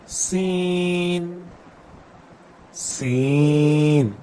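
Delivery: سین drawn out slowly with a whistling s; whistling seen. Shown as a demonstration. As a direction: stretch the hiss of سین. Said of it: "سیٹی سی آواز نکلے گی". (سیٹی جیسی سین)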